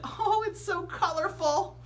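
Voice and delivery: very high, slow, gushing with a laugh; delighted teacher. A narrator's reading. Teacher clapping at the costumes, high and gushing. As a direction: coo it with a little laugh of delight. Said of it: "clapped her hands and said".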